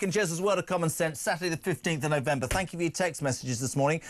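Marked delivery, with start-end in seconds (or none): none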